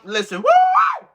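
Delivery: drawn-out high shouted whoop; ecstatic woo. Real, not acted. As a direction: stretch the woo up high and loud. Preacher's ecstatic cry.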